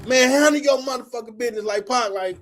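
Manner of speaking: imitating himself yelling at a young line brother; retold scolding. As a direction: chew the kid out in the hallway. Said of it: "All I heard was".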